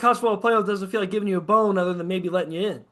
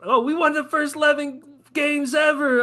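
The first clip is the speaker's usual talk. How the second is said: higher, shouted mock boast; mocking brag; 7 semitones higher than usual. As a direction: parody a smug fan bragging. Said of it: "when you flaunt an eleven game season".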